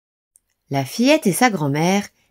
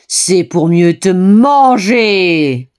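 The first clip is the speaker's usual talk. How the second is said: very loud, very slow roar; wolf bellowing as he pounces; 8 dB louder, much slower than usual. A narrator's reading. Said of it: "hurla le loup". (le loup hurle, très fort et lentement)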